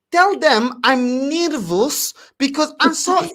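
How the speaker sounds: high, slow, mock-fretful foreign mum; imitating a stressed mum on the phone; mum's broken English. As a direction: play the flustered immigrant mum, high and slow. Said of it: "with her broken English".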